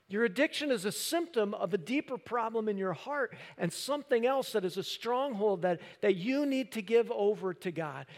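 loud, high preaching; full-force preaching. Acted. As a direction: preach it hard to the crowd.

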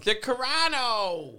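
high, drawn-out whine; whiny voice. Shown as a demonstration. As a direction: whine the name slowly. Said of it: "I like to use my whiny voice".

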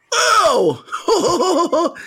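high shouted oh; pained shout. Retold or imitated. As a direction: friend reacting to the whack. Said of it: "my friend goes".

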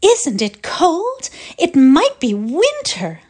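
high, loud and slow, sugary and put-on; sweet affected drawl. A narrator's reading. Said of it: "she cried in her sweet, affected drawl".

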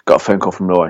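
usual speaking voice

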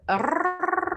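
a crrr with a long trilled rolled r, pitched high. Shown as a demonstration. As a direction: roll the r in crrr hard. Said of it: "You can't roll your tongue".

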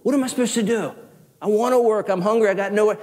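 high-pitched exasperated plea. Acted. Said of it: "he's kind of exasperated".

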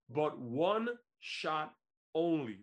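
"one shot" drawn out very slowly, "only" falling; slow weighty "one shot... only"; stress on one shot only. Real, not acted. Hyping the one-time title shot.

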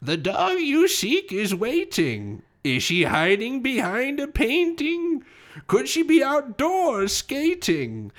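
high, slow crone voice rhyming; strange old woman. Acted. Game master voicing the old woman on the fire escape, high and slow. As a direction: croak out a riddle like a cackling old witch. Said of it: "and she says".

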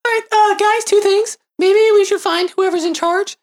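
high, squeaky character voice. Acted. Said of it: "did your voice stones drop".

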